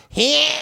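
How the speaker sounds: "Ham" high with a stretched flat A; nasal flat A in ham. Shown as a demonstration. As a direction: push the A in "Ham" up. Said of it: "I don't know that A".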